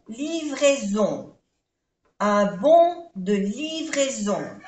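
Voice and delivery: "livraison" slow, nasal on at end. Shown as a demonstration. Teacher spelling and repeating "livraison" slowly. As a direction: finish "livraison" on a nasal vowel. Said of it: "A I E O N".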